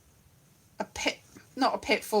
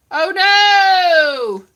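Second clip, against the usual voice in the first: loud, higher hammed-up oh no; mock dismay; 6 semitones higher, 10 dB louder than usual. Reacting to theatres closing with loud mock horror. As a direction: cry out in theatrical despair.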